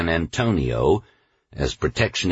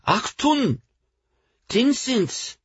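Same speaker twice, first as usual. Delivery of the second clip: put-on much higher child cry; slower than usual. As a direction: call it out sharply to passersby. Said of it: "she cried".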